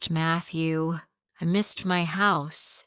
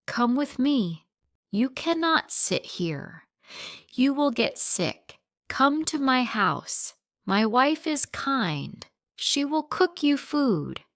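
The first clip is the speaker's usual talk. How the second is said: lightly voiced kind officer, higher and caring; gentle insistence; 7 semitones higher than usual. Police officer urging abandoned mother to come home, gently pleading. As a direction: coax her to come home with him.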